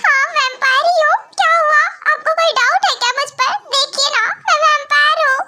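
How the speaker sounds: high-pitched, whiny self-defence; tearful protesting. Acted. Crying vampire insisting to brother he is a vampire, tearful protest. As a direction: protest through put-on tears. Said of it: "हाँ, रो रहा है".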